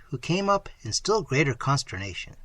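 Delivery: ordinary delivery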